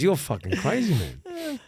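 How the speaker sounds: high-pitched disbelieving mate; mock incredulous friend, voice jumping high. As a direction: pitch it up like he can't believe you. Mate's disbelieving reply retold.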